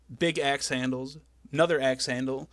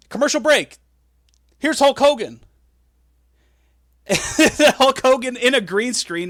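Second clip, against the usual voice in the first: excited; 10 semitones higher, 12 dB louder than usual. Real, not acted. Laughing at absurd commercial.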